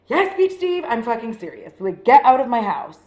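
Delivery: re-enacted fed-up comeback with punched words; exasperated retort. Telling a lingering date she is serious, exasperated. Answers date who won't leave.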